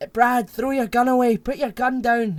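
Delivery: high, loud pleading order; urgent mock shout. Detective begging partner to drop gun, mock shout.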